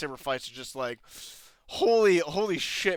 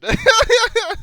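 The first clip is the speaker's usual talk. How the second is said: sudden loud laugh; high burst of laughter; 10 dB louder than usual. Real, not acted. Laughing at the scene.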